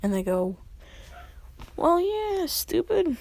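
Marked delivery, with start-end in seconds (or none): 1.8-3.2 high, loud mock exasperation; scoffing. Friends telling Lubbock off for a dumb question, high scoffing. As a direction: pitch it way up, drawn-out and incredulous.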